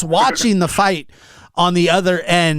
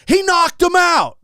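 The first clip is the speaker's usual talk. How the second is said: very high, drawn-out excited holler; retold ecstatic yell; 14 semitones higher than usual. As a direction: imitate a friend screaming big news. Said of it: "And he go".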